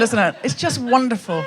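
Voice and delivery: ordinary talk, nothing marked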